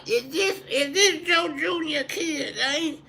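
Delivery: put-on very high, slow drunk relative voice. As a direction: greet him like a tipsy uncle. Drunk relative recognizing him.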